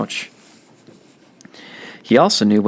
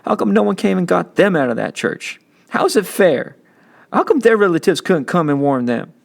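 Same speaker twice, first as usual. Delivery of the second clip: put-on a boy's voice, higher and pleading; a young boy's higher voice, questioning through hurt; upset young boy; 6 semitones higher than usual. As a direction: plead like a hurt little kid asking why. Kenny pleading, upset child.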